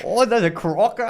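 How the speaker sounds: high, loud excited Aussie; thrilled wildlife host spotting a croc; Aussie Steve Irwin accent. Pretend. Friend continues the Irwin bit, loud excited Aussie. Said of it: "do your best Aussie accent".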